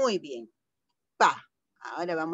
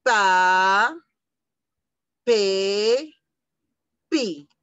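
Pa, pe, pi each called out loud with a pause between; syllable reading; 6 dB louder, much slower than usual. Shown as a demonstration. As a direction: chant the syllables one by one for little kids. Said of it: "A ver con ustedes".

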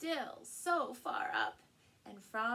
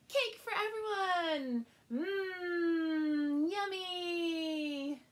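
loud, high cheer then delighted mmm yummy; joyful cheer; 5 semitones higher, 7 dB louder, slower than usual. A narrator's reading. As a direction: celebrate the cake with the child. Happy ending read to child.

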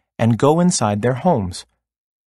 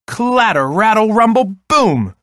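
high, louder rolling rumble dropping onto boom; excited storybook clatter, pitched way up; thunder sound words; 9 semitones higher, 4 dB louder than usual. A narrator's reading.

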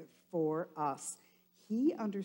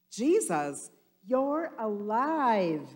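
louder, higher joyful cheer, slow; 5 semitones higher, 7 dB louder, slower than usual. Acted. Disciples cheering at the risen Jesus, loud and high. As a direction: cheer him back to life. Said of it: "they cheered".

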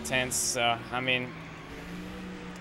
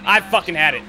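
real fury, higher and louder throughout; furious rant; 7 semitones higher, 6 dB louder than usual. Real, not acted. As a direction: vow revenge at the top of your voice. Ranting at rival drivers.

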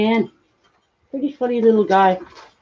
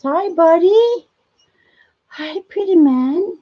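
high, slow sing-song coo at the pet; 7 semitones higher, slower than usual. Real, not acted. Owner coos slowly and high at her pet chipmunk. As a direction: coo slowly to a tiny animal.

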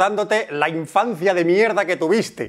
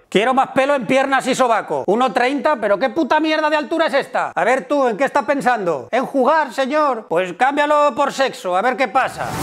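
voz más aguda, dando órdenes; jefe nuevo mandón; 6 semitones higher than usual. Acted. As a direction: interpreta a un jefe exigente que pasa revista. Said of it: "es el jefe nuevo que llega a la empresa". (jefe nuevo dando órdenes con voz aguda)

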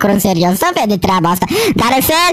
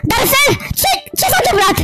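high, stammering alarm; acted panic, pitched well up; panicked; 9 semitones higher than usual. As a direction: call out frantically, tripping over the words. Acted alarm, calling Darcel.

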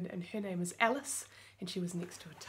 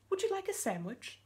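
polite mummy; 9 semitones higher than usual. Acted. Mummy offers sandwich.

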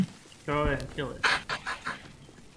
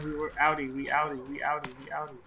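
normal talking voice

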